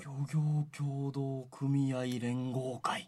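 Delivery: slow, low, soft recitation of a tongue twister; 早口言葉もどき. Pretend. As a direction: draw out the long name slowly and quietly. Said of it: "早口言葉かのような". (早口言葉のような名前を低くゆっくりと)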